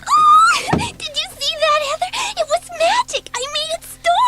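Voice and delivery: high, laughing giddy delight; giddy glee. Acted. Girl laughing to Heather that she made the storm, giddy. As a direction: bubble over with delight at your own power. Girl gloating over her magic.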